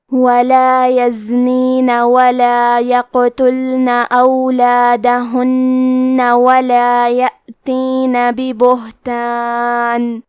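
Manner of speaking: slow melodic Quran recitation. Acted. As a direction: recite the verses in a slow measured chant. Recites the whole surah.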